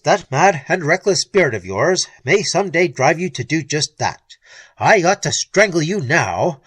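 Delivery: intense threat. Acted. Frank threatens to kill Perry.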